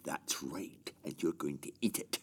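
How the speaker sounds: low, soft threat; quietly menacing father giving an order. Acted. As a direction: drop the voice and menace him quietly. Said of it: "You were very menacing".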